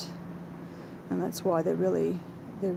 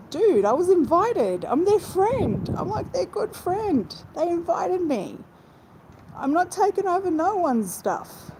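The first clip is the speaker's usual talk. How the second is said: much higher, louder indignant protest; indignant retort; 10 semitones higher, 3 dB louder than usual. Retold or imitated. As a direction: fire it back, outraged at the accusation. Retells indignant reply to troll.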